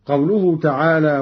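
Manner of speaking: usual speaking voice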